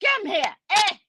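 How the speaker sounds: high calls of eh, come here; yelling at cousin. Retold or imitated. Cousin yelling across the table to be recognised. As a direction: call him over across the table. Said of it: "he starts yelling".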